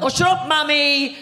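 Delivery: put-on high, loud whiny kid voice; childish brush-off. Adult child brushing off mother, high loud whine. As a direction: snap at mom like a bratty child. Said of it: "we're all like".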